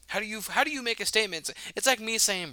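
real exasperation, voice jumping up high; heated outburst. Real, not acted. [name] to Brandon, fuming about the owner, heated and high. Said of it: "I am really heated about this".

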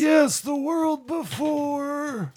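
slow, high, shouted proclamation; booming prophet. Acted. Cult leader proclaims prophecy.

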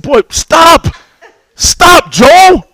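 high, rising desperate "stop" at his son; acted-out frantic dad; exasperated retold plea. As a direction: plead sharply, pitch climbing. Pleading with his screaming son.

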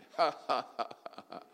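a short mock laugh of an old schoolmate; quick imitated chuckle. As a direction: laugh like the old friend you recognise. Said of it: "the person is laughing".

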